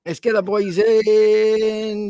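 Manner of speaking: slow, stretched-out summons; drawn-out call. Real, not acted. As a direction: call them in, holding the last word long. Calling the others into the chat.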